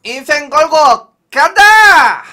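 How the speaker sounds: loud slow countdown, building to GO; countdown shout. Real, not acted. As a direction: yell the countdown like a launch. Countdown shout before the roll.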